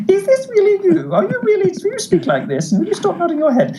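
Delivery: very high squeaky imitation; mock high-pitched mimicry. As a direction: mimic him way up in falsetto. Imitating host's reaction to him.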